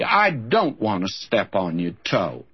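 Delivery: ordinary delivery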